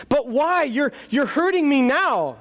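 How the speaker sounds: high, panicky pleading voice; a scared ghost begging the angel to stop; frightened ghost. Acted.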